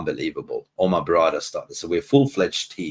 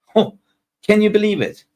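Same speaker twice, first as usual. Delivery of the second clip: excited disbelief; 12 semitones higher, 5 dB louder than usual. Real, not acted. Celebrating signings to chat.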